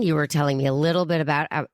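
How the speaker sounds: ordinary talk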